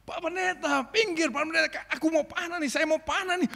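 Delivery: high, pressing call; warrior warning. Retold or imitated. Warrior warning the pastor out of range. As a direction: urge him aside before shooting.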